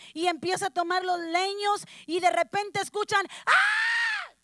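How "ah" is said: put-on high piercing child's scream; sudden shrill scream; girl's scream. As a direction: scream out in fright like a little girl. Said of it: "que gritó la niña".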